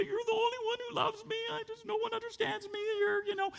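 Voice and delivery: high, fast whimpering little voice; whiny childish self-pity. Retold or imitated. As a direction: pitch it way up like a sulky child crying to the dog.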